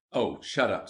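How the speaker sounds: ordinary delivery, nothing marked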